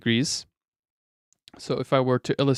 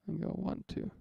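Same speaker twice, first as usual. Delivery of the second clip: usual speaking voice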